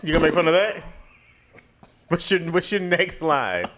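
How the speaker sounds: ordinary delivery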